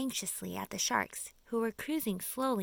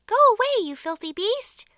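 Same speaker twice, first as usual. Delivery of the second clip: high, loud shout to drive it off; scared creatures shouting down at a shark; 12 semitones higher, 12 dB louder than usual. Acted. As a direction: yell it away. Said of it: "they shouted".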